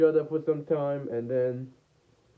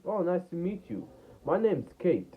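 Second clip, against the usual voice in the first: a hint of a higher female voice; lightly voiced girl; 6 semitones higher than usual. Girl introducing herself to a new friend, lightly voiced higher. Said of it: "she was like".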